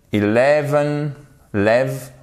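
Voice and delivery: eleven drawn out slowly on "lev"; stress on LEV. Shown as a demonstration. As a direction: lean on the middle syllable "lev" in eleven. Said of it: "votre accent tonique exactement sur ces trois lettres". (accent tonique sur « lev » de eleven)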